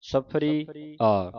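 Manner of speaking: ਸਫਰੀ then ਆ, stretched and lifted; slow demo. Shown as a demonstration. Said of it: "shows the reading".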